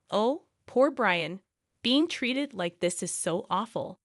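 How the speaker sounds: plain reading voice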